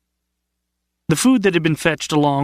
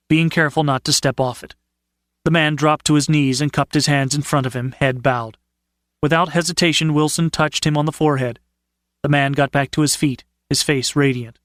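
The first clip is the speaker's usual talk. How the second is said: ordinary delivery, nothing marked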